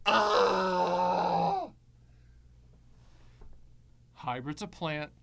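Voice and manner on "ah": mock cry of horror. Mock outrage continues.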